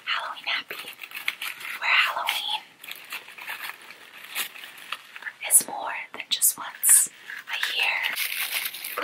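soft, breathy ASMR whisper; hushed whispered ASMR intro. Pretend. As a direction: whisper it like a cozy fall ASMR clip. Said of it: "Little fall A S M R".

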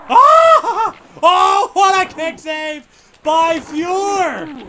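high, loud shouts at the goalie; frantic yelling. Real, not acted. Gamer shouting at his goalie during a scramble, high and loud. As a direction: yell at the screen in disbelief.

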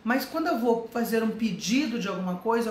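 ordinary delivery

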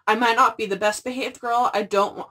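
ordinary talk

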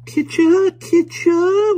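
very high, loud sing-song call; a nursemaid's nonsense cry like a sneeze; high sing-song kitcher kitcher; melodious cry. A narrator's reading. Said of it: "with a melodious cry of".

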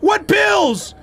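high, rising panic while reading a warning; panicked shouting. Real, not acted. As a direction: shout in alarm, pitch climbing high. Alarmed by low sanity warning.

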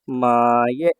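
"মায়ে" with a long, low, sustained first vowel; drawn-out pronunciation. Shown as a demonstration. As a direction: stretch out "মায়ে" slowly as a model to copy. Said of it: "এই যে মায়ে". ("মায়ে" লম্বা করে টেনে উচ্চারণ)

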